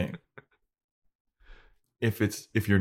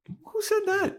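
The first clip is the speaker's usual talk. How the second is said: incredulous. Real, not acted. Mocking the characters' theory.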